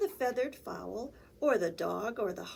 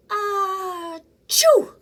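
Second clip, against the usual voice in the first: a rising ah then a loud burst of chew; big sneeze; 10 semitones higher, 12 dB louder than usual. Acted. Flea sneezing loudly, waking the barn animals. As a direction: build up then explode the sneeze.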